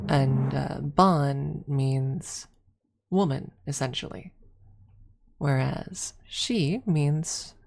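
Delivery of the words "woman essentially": ordinary talk, nothing marked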